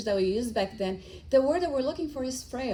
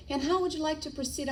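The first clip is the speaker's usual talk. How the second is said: higher, quick scripted politeness; scripted agent; 6 semitones higher, faster than usual. Retold or imitated. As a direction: rattle it off like a call-centre script. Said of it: "And then he's like".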